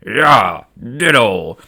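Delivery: a hint of the gruff Gungan boss; Boss Nass voice. Gungan boss seconding the queen's speech, in mock Gungan voice. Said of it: "Nass is like".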